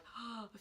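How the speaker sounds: soft imitated gasp of shock; small mock gasp. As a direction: mimic her little horrified intake of breath. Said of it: "made that little, like".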